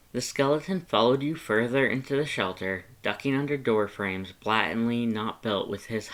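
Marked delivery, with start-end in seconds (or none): none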